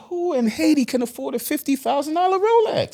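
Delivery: high, squeaking disbelief at the price; shocked question about a pricey watch in Haiti; incredulous disbelief. Retold or imitated. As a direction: ask it like you can't believe your ears. Incredulous reply to driver's claim.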